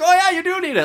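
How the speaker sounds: high, pushy mock cashier; aggressive cashier. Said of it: "she was so aggressive about it".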